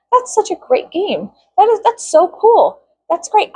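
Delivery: high mock-cheerful sarcasm; sarcastic praise. Mocking the jocks from school, high sarcastic gushing. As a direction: gush it, dripping sarcasm.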